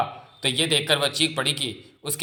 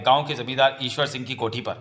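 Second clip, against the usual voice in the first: ordinary delivery, nothing marked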